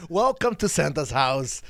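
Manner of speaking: ordinary talk, nothing marked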